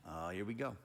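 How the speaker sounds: low, soft inner groan; quiet dread as the big question comes; wary aside. Retold or imitated. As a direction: mutter it to yourself, low and wary. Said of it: "Sizing me up".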